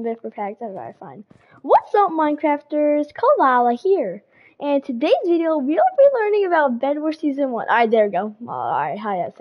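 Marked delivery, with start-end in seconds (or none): 1.6-7.7 elite Kalala voice. Shown as a demonstration. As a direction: announce it big, like a hammed-up YouTuber intro. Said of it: "once I say in the elite Kalal voice".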